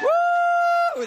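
high cheering whoop; cheering woo. Real, not acted. Cheering a volunteer on stage.

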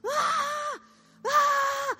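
high, loud mock sobbing wail; imitated child's wail. Mother imitates her distraught son, mock wailing. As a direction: wail like a child whose world is ending. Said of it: "he is sobbing it".

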